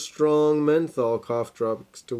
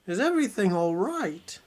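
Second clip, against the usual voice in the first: high, lightly voiced old lady; old granny voice; 8 semitones higher than usual. Sick grandmother greeting Red, high old-lady voice. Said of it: "That was a really bad voice".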